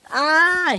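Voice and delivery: high, sustained "ah" scream; play-acted high scream of alarm. A narrator's reading. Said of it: "he screamed".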